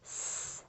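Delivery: "S" as a soft hissed s sound with no voice; letter sound. Shown as a demonstration. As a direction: hiss the s sound lightly. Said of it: "Give me the sounds".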